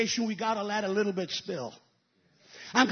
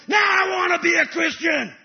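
loud preacher's cry, voice raised; preaching at full force; 7 semitones higher, 8 dB louder than usual. Acted. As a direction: shout your longing out to the church. Preacher shouting at climax.

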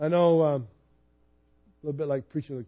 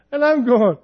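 usual speaking voice

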